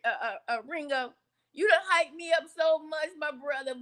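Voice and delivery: ordinary talk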